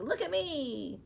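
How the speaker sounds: ordinary delivery, nothing marked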